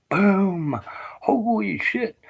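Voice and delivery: high awed burst; awed blast memory. Retold or imitated. Veteran recalls tank fire shaking him, high awed exclaim. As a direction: relive the blast in awe.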